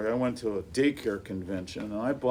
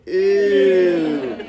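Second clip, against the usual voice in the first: imitating kids' ew; 6 semitones higher, 12 dB louder than usual. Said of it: "they go".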